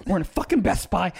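ordinary talk, nothing marked

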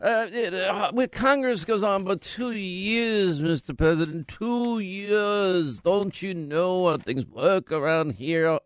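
sniffy, drawn-out complaint; fussy aggrieved senator; McConnell voice. Retold or imitated. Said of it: "should I do that voice".

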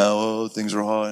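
slow, mopey sigh; preacher imitating his own temptation, mopey; mock emo whine. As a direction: mope it out. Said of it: "just get all emo".

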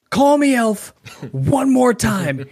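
Dinklage's author threatening. Retold or imitated. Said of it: "and he's like".

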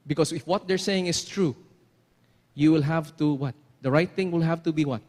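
normal talking voice

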